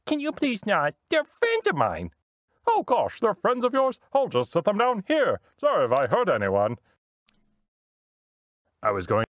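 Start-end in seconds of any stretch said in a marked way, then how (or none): 0.1-2.1 polite high droid plea. Acted. Droid pleads with monster. 2.7-6.8 apologetic high monster voice. Acted.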